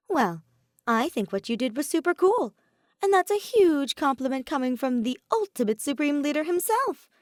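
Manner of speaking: high, loud boastful voice; loud and proud. A narrator's reading. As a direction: brag it out proudly. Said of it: "He spoke loud and proud".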